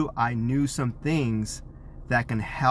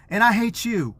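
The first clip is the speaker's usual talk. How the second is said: mock scream; 8 semitones higher than usual. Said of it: "she was screaming out of the top of her lungs".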